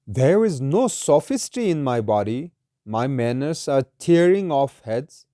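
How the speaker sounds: slow, measured verse reading; solemn, deliberate poetry reading; poem recitation. Acted. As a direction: recite it slowly, drawing out each phrase.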